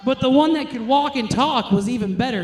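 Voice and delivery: ordinary talk, nothing marked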